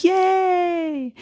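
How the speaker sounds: high-pitched drawn-out little cheer; mock-girlish squeal of joy; retold delight. Retold reaction to good news.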